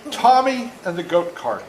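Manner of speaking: ordinary delivery, nothing marked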